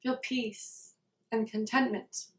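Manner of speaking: soft meditation voice with long silences; guided meditation. Real, not acted. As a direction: settle into a quiet, spacious recitation of a wish.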